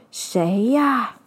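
lightly voiced old man at the door; a hint of a gruff grandpa calling. Old man answers the scratching at his door, lightly voiced. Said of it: "一位老公公打开门来问".

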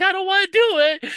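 very high, loud squeal of protest; hammed-up high-pitched whine; whiny mock reluctance. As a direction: imitate a scared boy refusing. Retold Kim refusing the Klingon woman.

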